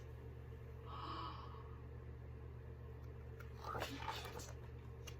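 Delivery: long breathy surprised gasp; soft suspense gasp. Acted. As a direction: gasp in wonder.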